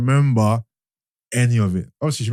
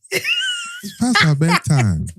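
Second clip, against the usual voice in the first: very high, drawn-out fake Ghanaian accent; mock Ghanaian accent; much slower than usual. Host teasing the sisters in a high mock Ghanaian accent. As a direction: stretch it out in a bad Ghanaian accent. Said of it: "give me a good Ghanaian accent then".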